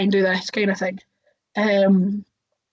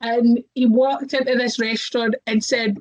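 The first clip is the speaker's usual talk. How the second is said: ordinary delivery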